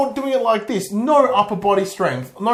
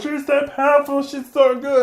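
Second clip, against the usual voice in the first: mock gushing; 8 semitones higher than usual. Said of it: "everyone's like".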